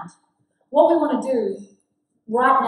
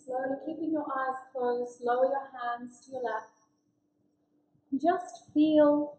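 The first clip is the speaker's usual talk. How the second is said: guided meditation; 8 dB quieter than usual. Acted. Facilitator guiding the group with closed eyes, soft meditation voice. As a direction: guide them into calm, softly.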